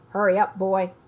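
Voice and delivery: loud bellow across the house; shouted. Acted. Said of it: "shouted Uncle Vernon".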